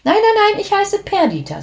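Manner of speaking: high, bright little witch voice. A narrator's reading. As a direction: play the little witch correcting her name, high. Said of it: "sagt die kleine Hexe". (kleine Hexe, hohe Stimme)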